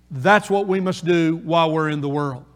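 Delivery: ordinary talk, nothing marked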